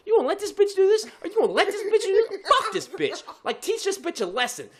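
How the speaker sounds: high-pitched egging-on inner voice; goading ego voice. Acted. Ego goading driver to teach line-cutter a lesson, high and indignant. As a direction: needle him in a high, insistent squeal. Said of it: "my mind's going with my ego shit".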